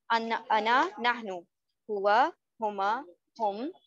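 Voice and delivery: measured table recitation, a pause after each group; repeat-after-me drill. Shown as a demonstration. Teacher leading students to memorise the table, repeat-after-me drill. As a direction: recite word by word, lilting up, then closing each set. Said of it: "साथ साथ हमारे repeat करना है".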